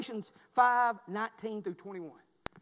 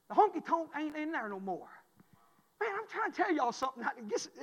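usual speaking voice

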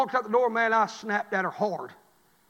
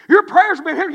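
higher and much louder repeat; preaching cry; 9 semitones higher, 12 dB louder than usual. Acted. Preacher repeating the warning to the church, crying out. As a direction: hammer it home harder.

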